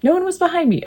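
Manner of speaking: plain reading voice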